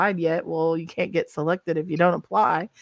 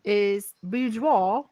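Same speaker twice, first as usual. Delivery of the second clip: very slow mock-British; drawn-out British announcement; British accent; much slower than usual. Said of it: "say it in a British accent".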